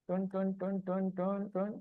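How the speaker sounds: very soft, idle "ton, ton, ton"; idle "ton ton" noises. Real, not acted. Teacher filling a wait during class, idle soft "ton ton". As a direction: sound out little tones under your breath. Idle sounds while working the slide.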